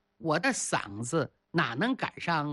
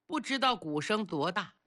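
usual speaking voice

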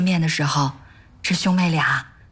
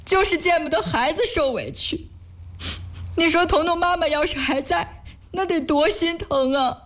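higher-pitched, upset lament; tearful grief; 7 semitones higher than usual. Acted. Wife remembers her late mother to husband, tearfully. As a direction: speak through rising tears. Grieving over dead mother, being comforted.

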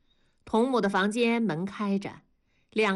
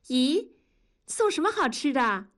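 surprised; 5 semitones higher than usual. Acted. Child greets surprise food.

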